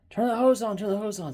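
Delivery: high-pitched urgent order, repeated; urgent neighbor. Retold or imitated. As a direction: imitate a neighbor calling out instructions in a hurry. Retold neighbor calling out urgently.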